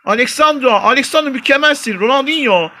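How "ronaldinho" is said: normal talking voice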